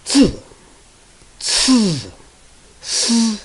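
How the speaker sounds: zi ci si with the teeth closed; teeth together. Shown as a demonstration. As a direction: zi ci si, teeth never apart. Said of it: "the teeth never apart".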